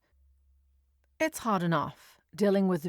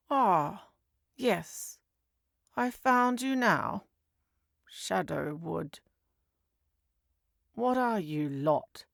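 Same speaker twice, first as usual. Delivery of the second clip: slow, unimpressed drawl of a desk clerk; a hint of a sceptical police receptionist, slow; lightly voiced bored desk clerk; 2 semitones higher, slower than usual. A narrator's reading. Desk lady to the agents, unimpressed and slow.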